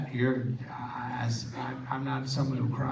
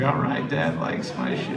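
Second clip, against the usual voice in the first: laughing; 8 semitones lower than usual. Retold or imitated. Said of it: "I was like".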